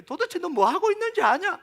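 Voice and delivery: high, loud mock reproach; imitated rebuke. Jesus challenging frantic Martha, in retold exasperated rebuke. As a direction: demand it as an acted scolding.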